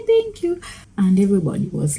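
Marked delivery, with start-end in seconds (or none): 0.1-0.6 retold thanks. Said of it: "She said".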